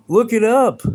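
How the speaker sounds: irritated streamer snapping back at chat; annoyed outburst. Real, not acted. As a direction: bite it off at the troll. Snapping at a chatter.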